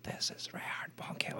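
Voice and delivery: starstruck whisper of disbelief; awed whisper. Retold or imitated. Recalling sitting beside his hero, hushed awed whisper. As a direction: breathe it out, stunned he sat next to you. Starstruck thought at lunch table.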